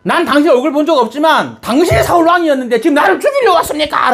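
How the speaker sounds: high, hammed-up cry of accusation; acted shouting. Medium confronts disguised King Saul fearing death, acted high shout. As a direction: cry it out at high pitch, as if horrified. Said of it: "고함 지르는 겁니다".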